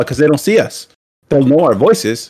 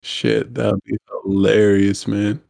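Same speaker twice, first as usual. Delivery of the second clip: ordinary talk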